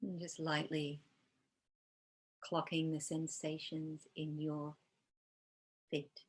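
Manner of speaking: calm, unhurried meditation guide with long pauses; guided meditation. Real, not acted. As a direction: gently lead them into body awareness, leaving space between phrases.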